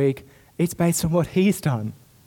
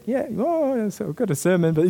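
hesitant reply; 5 semitones higher than usual. Retold or imitated. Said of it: "And I said".

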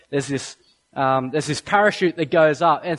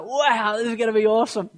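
higher, awed movie-fan reaction; wowed viewer; 5 semitones higher, faster than usual. Acted. Preacher acting out a viewer watching a war-movie style ad, wowed. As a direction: play a mildly thrilled ad watcher. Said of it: "you're just like".

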